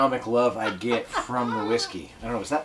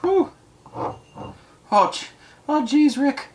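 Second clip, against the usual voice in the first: tipsy high exclamation; much slower than usual. Real, not acted. Drunk reaction to strong whiskey.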